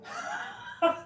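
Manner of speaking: a sudden, high, breathy laugh. Real, not acted. Laughing after the shout.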